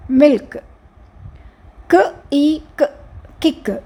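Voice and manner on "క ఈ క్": "క, ఈ, క్" as three separate sounds; sounded out. Shown as a demonstration. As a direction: break "క, ఈ, క్" into short k, ee, short k. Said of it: "వీక్ సౌండ్స్కి ఒక vowel sound".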